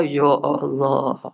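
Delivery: drawn-out, low, soft lament; a slow, sorrowful "Ya Allah"; dismayed sigh. Real, not acted. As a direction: groan it out slowly in dismay. Preacher sighs in dismay.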